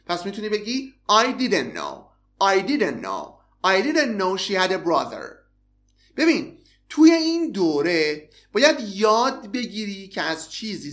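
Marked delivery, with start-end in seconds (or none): 1.1-5.3 "didn't" said as "didn", t dropped; casual spoken "didn't" with no final t; didn't without t. Shown as a demonstration.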